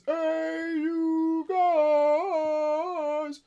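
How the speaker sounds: high, long-stretched goofy call; drawn-out, high-pitched comic yell; Goonies catchphrase holler. Acted. Goonies catchphrase shouted on seeing ship.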